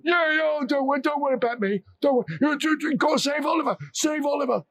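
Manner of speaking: put-on very high, fast, fussy old man; a dazed old villager insisting; old man brushing it off. A narrator's reading. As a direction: wave off the worry, hurry. Injured old man urges them on.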